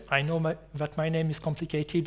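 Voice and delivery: ordinary delivery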